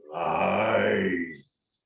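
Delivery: low, drawn-out dragon accusation; booming dragon voice. Acted. The ancient voice answers the returned property, low and booming. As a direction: draw out one word as a great dragon. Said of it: "it will say".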